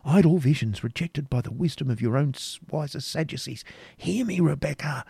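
a passionate knight tempting her to flee with him; animated. A narrator's reading. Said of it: "proceeding with animation".

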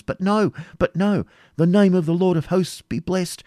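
high-pitched, eager battle report; joyful eagerness. A narrator's reading. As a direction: exclaim with relief and rising excitement. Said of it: "shouted with joyful eagerness".

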